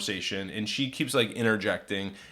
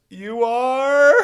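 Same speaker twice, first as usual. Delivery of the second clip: high, loud, stretched question; hammed-up shocked drunk woman; mock astonishment; 13 semitones higher, 9 dB louder than usual. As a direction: squeal it in exaggerated disbelief. Drunk woman stunned by the reveal.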